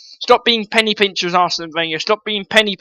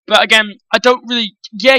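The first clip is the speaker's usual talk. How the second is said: usual speaking voice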